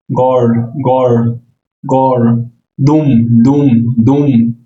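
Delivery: দুম said as Russian dom; Russian word. Shown as a demonstration. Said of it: "গড়ের উচ্চারণ Russian হলো দুম". (রুশ উচ্চারণে দুম)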